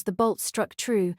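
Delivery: ordinary delivery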